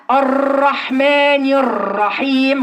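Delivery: الرحمن الرحيم with the راء trilled repeatedly; over-roll every r slowly. Shown as a demonstration. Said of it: "غلط". (تكرير الراء بمبالغة)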